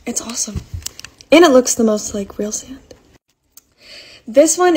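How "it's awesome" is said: whispering. Real, not acted. Quick aside about kinetic sand.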